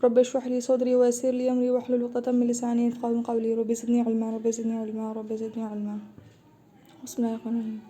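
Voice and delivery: soft recited Arabic invocation; opening prayer. Real, not acted. Teacher opening the lesson with a quiet recited prayer. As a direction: recite the du'a quietly before class.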